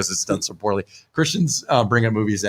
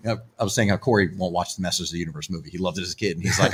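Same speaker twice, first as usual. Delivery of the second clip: ordinary delivery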